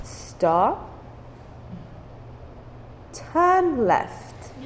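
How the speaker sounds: quiet, gentle instructions; soft mom. Retold or imitated. Patient mom softly coaching her daughter driving. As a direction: calmly guide the nervous driver. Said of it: "she was like".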